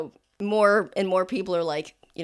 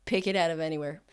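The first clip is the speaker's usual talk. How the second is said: ordinary talk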